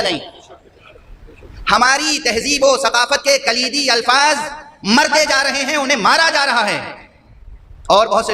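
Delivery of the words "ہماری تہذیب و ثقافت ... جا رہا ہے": high, insistent sermon voice; impassioned preaching. Real, not acted. Preacher lamenting the culture's dying key words, impassioned. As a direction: preach it high, pressing the point home.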